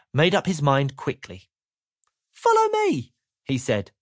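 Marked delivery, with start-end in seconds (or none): 2.3-3.1 very high, bright command; cheerful Mister Happy. Acted. Mister Happy tells Mister Miserable to follow, bright and high. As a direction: lead the way brightly.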